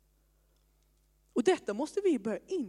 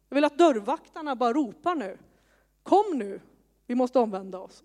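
rising, louder preaching that ends in a call; fiery preaching; 2 semitones higher, 6 dB louder than usual. Acted. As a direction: preach it out, building to a call to the doorkeepers. Preaching builds to a loud call.

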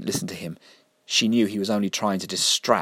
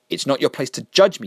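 loud, higher angry snap; snapped; 6 semitones higher, 6 dB louder than usual. Acted. Mister Vinegar snapping angrily at Jessica. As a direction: snap at her in fury. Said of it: "Mister Vinegar snapped".

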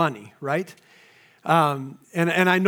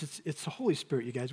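usual speaking voice

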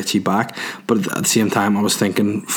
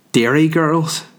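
higher, drawn-out mock Derry accent; bad Derry accent; 6 semitones higher, slower than usual. Comic naming a TV show in a badly faked Derry accent. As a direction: put on a terrible Derry accent, slow and raised. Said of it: "That was the worst Derry accent ever".

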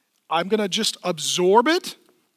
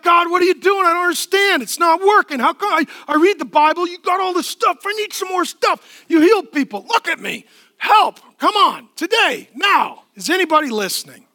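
much higher and louder, demanding and impatient; complaining prayer; 11 semitones higher, 10 dB louder than usual. Retold or imitated. Frustrated believer demanding answers from God, high impatient pleading. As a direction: pester God like an impatient child. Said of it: "you're like".

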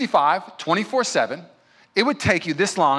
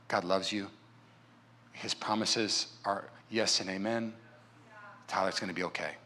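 much softer, lower, tender reassurance; gentle self-talk; 8 semitones lower, 9 dB quieter than usual. Shown as a demonstration. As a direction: soothe yourself like a scared child. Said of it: "kneel down and say".

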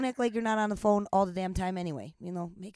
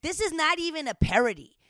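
raised, louder retold protest; exasperated outburst; 6 semitones higher, 5 dB louder than usual. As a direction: burst out in disbelief, higher and louder. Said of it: "because I'm like".

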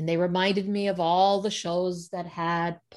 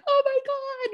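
shocked. Retold or imitated. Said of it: "and he's like".

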